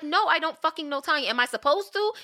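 high, exasperated acted comeback; indignant retort. Asking Lily who Tanya even is, indignant. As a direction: throw back incredulous questions, pitch climbing. Retelling quarrel over knowing Tanya.

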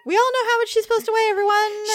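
high, fast mock announcer. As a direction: call it to the crowd, fast and high. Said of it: "Ronda Rousey".

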